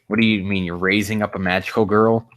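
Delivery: ordinary delivery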